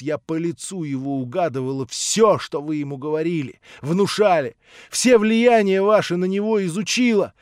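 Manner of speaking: Natasha's rising accusation. Acted. Natasha confronting the prince, building indignant accusation. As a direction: hurl the charges at him, building.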